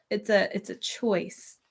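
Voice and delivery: ordinary talk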